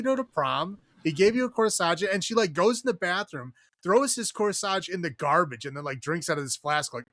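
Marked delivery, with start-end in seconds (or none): none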